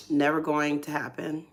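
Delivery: ordinary delivery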